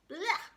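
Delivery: high disgusted splutter; spluttering blech. A narrator's reading. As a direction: spit out a yucky blech. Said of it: "spluttered Katie".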